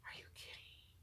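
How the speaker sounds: a stunned whisper; whispered disbelief. Retold or imitated. Told she can go home, whispers in disbelief. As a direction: breathe it out in disbelief. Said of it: "And I was like".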